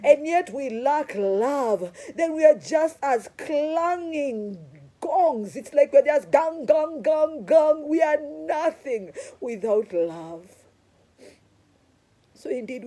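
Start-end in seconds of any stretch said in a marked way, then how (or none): 0.0-5.5 loud, high, slow preaching cry; full-force preaching. Acted. Preacher crying out to the congregation about love, loud and high. 5.5-7.9 loud, high clanging gang gang gang; clanging gong. Acted. Preacher mimicking an empty clanging gong, loud and high. 7.9-10.5 loud, high, slow preaching cry; full-force preaching. Acted. Preacher crying out to the congregation about love, loud and high.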